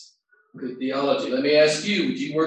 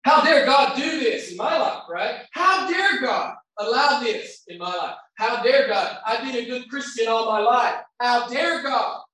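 higher, louder mock indignant cry; imitating a resentful believer, raised and indignant; retold angry outburst; 10 semitones higher, 5 dB louder, slower than usual. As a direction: imitate a bitter churchgoer railing at God. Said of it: "those weren't the exact words".

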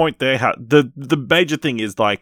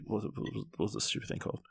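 muttering to himself; 15 dB quieter, faster than usual. Real, not acted. Searching memory for a title.

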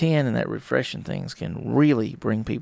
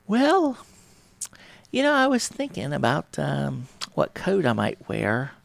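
higher, drawn-out dodge; evasive partner; 4 semitones higher, slower than usual. Acted. Partner dodging a date question, higher slow stalling. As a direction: stall like someone avoiding the question.

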